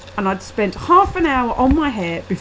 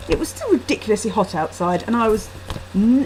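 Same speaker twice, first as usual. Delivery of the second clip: ordinary delivery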